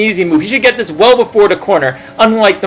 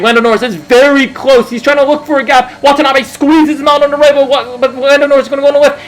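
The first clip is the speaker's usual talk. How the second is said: motorsport commentator; 6 semitones higher than usual. Acted. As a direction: call every move as it happens.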